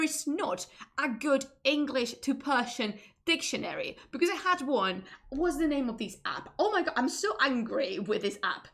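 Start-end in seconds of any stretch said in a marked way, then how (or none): none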